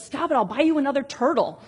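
mock exasperated father cutting off the crying; frustrated dad. Frustrated father to crying daughter, impatient snap. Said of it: "he gets frustrated and he says".

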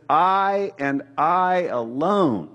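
every word slow and weighted, a stressed "I" and "alone"; firm declaration, one heavy beat per word; emphatic. Shown as a demonstration. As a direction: lean hard on "I and I alone", slow and deliberate. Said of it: "It's very emphatic in the Greek".

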